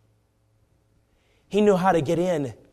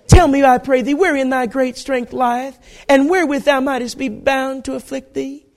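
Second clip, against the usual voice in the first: high-pitched Southern wheedling; Delilah, Southern accent; 10 semitones higher, 6 dB louder than usual. Acted. Delilah asking where his strength lies, Southern coaxing. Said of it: "probably had a deep southern accent".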